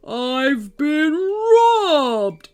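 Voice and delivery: the troll bellows in shock over his empty pot; alarmed shout. Acted. The troll finds his soup pot empty, slow loud alarmed troll shout. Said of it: "shouted the troll with alarm".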